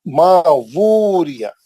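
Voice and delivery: मा वूरिया with a clear w before the oo, slowly; correct pronunciation. Shown as a demonstration. Teacher demonstrates the correct reading to students. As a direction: sound the व fully in वूरिया. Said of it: "डब्ल्यू के साथ पढ़ना".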